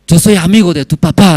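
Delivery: high, loud acted announcement; delighted recognition. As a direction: reveal the connection eagerly. Acts president recognising friend's child.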